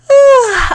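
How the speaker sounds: a high loud yawn falling away. Acted. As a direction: yawn to dodge the question. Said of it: "Sakura yawned again".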